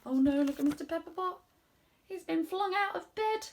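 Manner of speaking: plain reading voice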